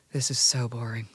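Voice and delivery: imitating a dying man's faint whisper; retold whisper. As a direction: breathe it out as a weak whisper, recalling grandpa. Dying grandfather's words retold.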